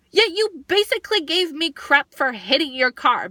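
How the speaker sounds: exasperated storyteller's comeback; indignant retort. Retold or imitated. Indignant retort to the other driver.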